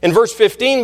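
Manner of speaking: ordinary talk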